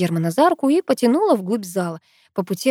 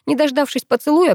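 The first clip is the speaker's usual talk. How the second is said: ordinary delivery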